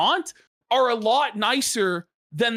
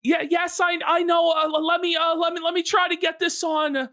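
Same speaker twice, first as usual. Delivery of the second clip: quoting flustered Tarl; 7 semitones higher, faster than usual. Retold or imitated. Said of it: "So then Tarl goes".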